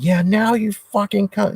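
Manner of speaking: mock rage yell at the landlord; retold furious yell. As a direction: relive the blow-up, pitch flying up. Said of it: "yelling at the top of my lungs".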